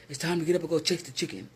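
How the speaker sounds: put-on a light take on an old ex-con's deep, brawly voice, a bit fast; quick, lightly voiced gruff old coworker rousing everyone for work; mimicking a coworker's deep brawly wake-up call; imitating deep brawly voice. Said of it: "He had this deep old brawly voice".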